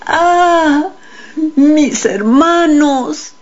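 high, drawn-out cry; narrated cry. A narrator's reading. Boy cries out to his brothers in the dream, high cry. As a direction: cry out high, stretching the words. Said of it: "grité".

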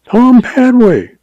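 high, slow frightened plea; pleading. A narrator's reading. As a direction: beg it out slowly, pitched high. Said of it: "pleaded the agent".

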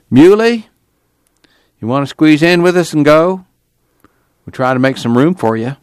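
calling across to Muley to come along. A narrator's reading. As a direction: raise the voice to reach him.

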